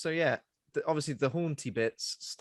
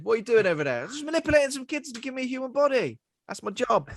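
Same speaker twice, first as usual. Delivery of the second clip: high, loud, fast cheery voice; chipper office worker; 12 semitones higher, 6 dB louder, faster than usual. Pretend. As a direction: chirp like a happy employee. Voicing Toby at work.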